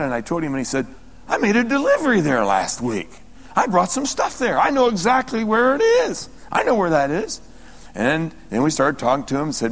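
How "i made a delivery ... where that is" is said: higher-pitched, retold excitement; excited recognition. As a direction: act out the man's eager recognition. Retelling the man's excited recognition.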